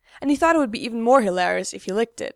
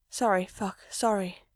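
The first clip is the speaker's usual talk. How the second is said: soft hurried apology; hushed sorry under the breath; whispered; 6 dB quieter than usual. A narrator's reading. Mikey apologising to Gerard, soft whisper. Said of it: "Mikey whispers".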